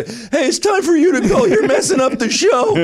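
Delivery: high, squeaky mock-teen voice; fourteen-year-old kid. Young bouncer telling a drunk heckler to leave, squeaky kid voice. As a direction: pipe up like a kid playing bouncer. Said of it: "these two kids come over".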